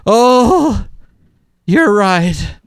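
hammed-up martyr mom giving in; self-pitying mom. Host imitating a guilt-tripping mom with mock self-pity. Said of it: "Mom pulls the old manipulation trick of getting down on herself".

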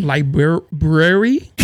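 "Library" stretched very slowly, rising; drawn-out library. Shown as a demonstration. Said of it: "how we pronounce shit wrong".

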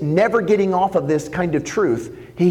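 ordinary talk, nothing marked

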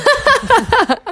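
high laugh through the word. Real, not acted. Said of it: "Just kidding".

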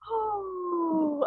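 a real sob breaking out; crying oh. Real, not acted. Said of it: "I was sobbing".

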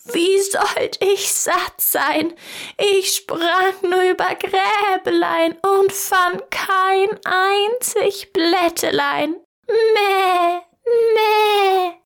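a goat complaining with a high bleat. Acted. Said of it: "die Ziege … rief". (hohe, langsame Ziegenstimme, das Meh noch höher)

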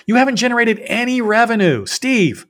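very high, loud retold yell; exasperated shout at a partner; freaking out. Founder confronting Steve over no revenue, freaking out. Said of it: "And I'm literally freaking out".

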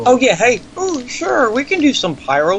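sarcastic singsong, high on sure; mock the careless club owner. Mocking the club that allowed pyro, sarcastic singsong.

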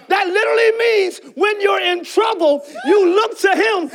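preacher getting carried away about troubleshooting; worked-up preaching. Real, not acted. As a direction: let the excitement carry you away. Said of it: "oh God, I gotta calm down".

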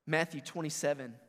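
ordinary talk, nothing marked

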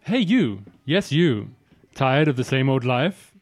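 salesman ad read voice; infomercial pitch. Acted.

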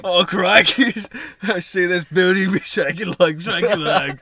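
loud, broad mock Australian; hammed-up Australian outback shout; Australian accent. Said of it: "do the Australian".

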